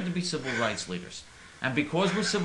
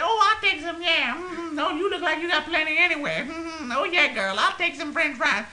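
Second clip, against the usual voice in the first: very high, laughing falsetto caricature; Brasilia's voice; 12 semitones higher, 4 dB louder than usual. Retold or imitated. Brasilia answers the fries lady in a high laughing falsetto. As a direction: chuckle and accept the fries like the friend. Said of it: "She said".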